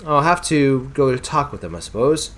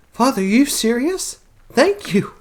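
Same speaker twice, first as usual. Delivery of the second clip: delighted daughter; 10 semitones higher than usual. Acted. Daughter thanks father.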